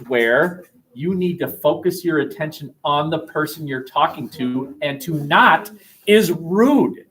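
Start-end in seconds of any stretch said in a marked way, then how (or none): none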